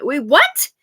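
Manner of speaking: loud, shooting up high at the end; shocked disbelief. Retold or imitated. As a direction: react with incredulous, rising questions. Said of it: "I'm like".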